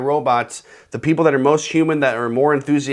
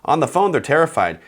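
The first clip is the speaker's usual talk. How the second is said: ordinary talk, nothing marked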